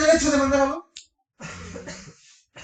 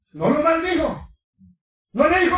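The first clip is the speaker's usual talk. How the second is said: usual speaking voice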